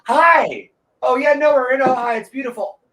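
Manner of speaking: put-on high-pitched phone chat; fake phone call. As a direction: chirp it as if taking a pretend call. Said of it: "took a fake phone call".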